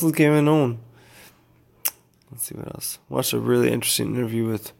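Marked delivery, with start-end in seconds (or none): 2.3-3.0 whispered to himself, much softer. Real, not acted. Musing aloud to his viewers, in a whisper. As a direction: breathe it to yourself under your breath.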